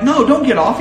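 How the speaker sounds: high, loud scolding of a dog; imitated verbal correction. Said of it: "a verbal correction for outing".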